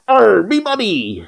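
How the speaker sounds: high, drawn-out pirate cry; hammed-up pirate wail over stolen loot; mock pirate. Player parodies the money line, mock pirate cry.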